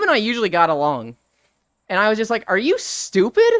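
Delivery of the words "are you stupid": higher-pitched, exasperated telling-off; indignant retold outburst. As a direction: tell the teacher off, retelling an old grievance. Retold outburst at sixth grade teacher.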